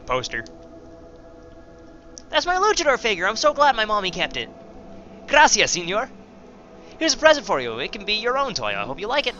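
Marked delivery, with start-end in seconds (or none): 2.3-9.4 put-on high-pitched little kid voice; little girl, delighted. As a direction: gush in a high child's voice. Reads out the dead girl's lines.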